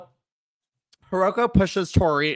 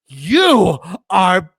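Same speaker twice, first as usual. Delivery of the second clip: very slow, louder, laughing; mock Hiroko; 6 dB louder, much slower than usual. Retelling Hiroko's insult, slow, loud and laughing. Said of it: "Hiroko comes over".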